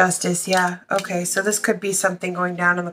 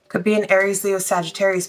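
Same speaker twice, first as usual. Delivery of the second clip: usual speaking voice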